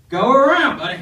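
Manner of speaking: shouted high and loud; loud dismissive yell. Retold or imitated. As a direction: holler it at the truck driver. Redneck keeps yelling at him.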